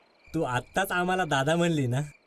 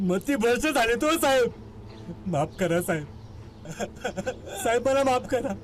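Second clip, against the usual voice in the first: pleading; 9 semitones higher than usual. Acted. Confessing accused begs officer.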